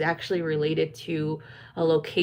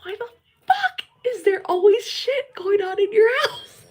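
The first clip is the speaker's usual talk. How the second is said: high-pitched imitation of a panicked husband; freaking out; 3 dB louder than usual. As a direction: start hushed, then burst into a mock squeal. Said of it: "he was freaking out".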